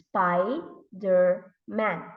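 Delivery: very slow "Spider-Man", SPI - DER - MAN; syllable by syllable. Shown as a demonstration. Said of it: "what are the sounds in the word".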